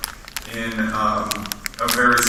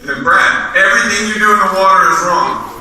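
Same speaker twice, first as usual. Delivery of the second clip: blunt coach; 5 semitones higher, 4 dB louder than usual. Retold or imitated. Coach gives blunt advice.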